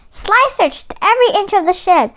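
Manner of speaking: ordinary delivery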